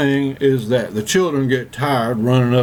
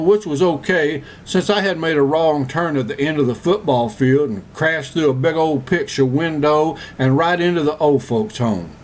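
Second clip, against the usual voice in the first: ordinary talk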